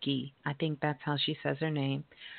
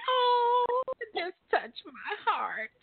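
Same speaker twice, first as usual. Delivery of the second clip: touched, near tears; 4 dB louder, slower than usual. Real, not acted. Moved by sweet baby.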